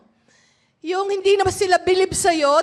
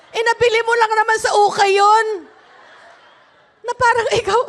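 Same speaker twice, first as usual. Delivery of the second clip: ordinary delivery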